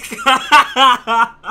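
high, loud cackling laugh; loud burst of laughter. Real, not acted. Laughs at his own joke.

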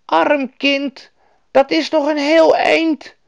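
very high, pitying woman's voice; loud, sympathetic storybook woman; Lapland woman. A narrator's reading. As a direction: exclaim over a poor child. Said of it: "zei de Laplandse vrouw".

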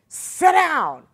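loud, high-pitched command; brusque mock order. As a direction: fire it out as an abrupt order. Acting the doctor's brusque order.

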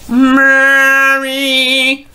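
high loud crooner cry; [name] impression. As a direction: belt it like an old vaudeville singer. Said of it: "That's all Jolson".

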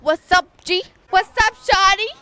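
eshay slang. Acted. Eshay shouting greetings loudly.

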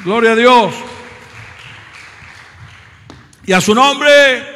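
loud rising preacher's cry, building to shouts; rally the congregation with shouted praise; fervent pulpit exclamations; preaching at full force. Acted.

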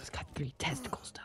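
unvoiced joking whisper. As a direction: whisper it like a secret. Crude joke whispered aside.

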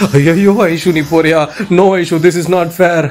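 put-on wail, drawn out at first; mock crying. Housemates lamenting Aishu's eviction, mock tearful wailing. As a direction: exaggerate a tearful protest in mockery. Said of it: "அப்படி இப்படின்னு எல்லாரும் அழுதாங்க".

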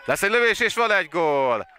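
high, loud goal call; excited hungarian sports commentary. Real, not acted. Live goal commentary, goal scored.

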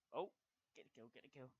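ordinary talk

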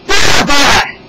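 loud, high cry of annoyance; vexed king. A narrator's reading. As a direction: burst out like a thwarted king. Thwarted king exclaims.

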